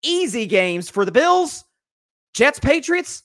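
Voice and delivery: said slowly, as if in air quotes: "easy games for the Bills"; sarcastic, slow "easy games", as if between quotes. Real, not acted. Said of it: "I say this emphatically in air quotes".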